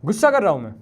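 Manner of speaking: restrained angry call. Real, not acted. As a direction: let some anger out, falling at the end. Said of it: "मुझे थोड़ा गुस्सा आ गया".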